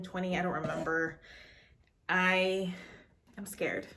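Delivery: normal talking voice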